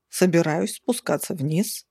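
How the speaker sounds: plain reading voice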